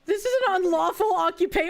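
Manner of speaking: rushed, clipped-off officer line; fast cop read. Shown as a demonstration. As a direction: race through the arrest line. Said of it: "oh, we're gonna go sovereign citizen".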